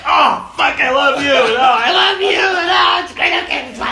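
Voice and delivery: high, loud hammed-up outpouring of love; frantic gushing. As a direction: gush over each other after a collision. Acts out both gushing after collision.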